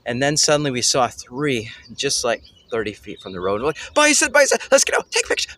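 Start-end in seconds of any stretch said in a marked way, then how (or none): 3.9-5.5 high, loud excited squeal of bison, bison. Retold or imitated. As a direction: blurt it out high like a thrilled kid. Spotting bison by the road.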